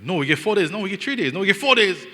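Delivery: high, loud back-and-forth contradiction; mock bickering. Aunties correcting his count of fasting days, high mock bickering.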